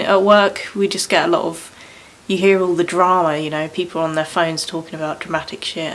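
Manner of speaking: ordinary talk, nothing marked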